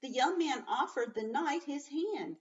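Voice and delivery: lively storybook read-aloud; reading to children. A narrator's reading. Teacher reading a story to her class, lively. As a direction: read it with energy to young kids.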